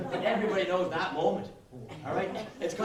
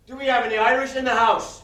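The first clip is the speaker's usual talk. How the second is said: calling out; 7 semitones higher, 11 dB louder than usual. Acted. Calling out to crowd.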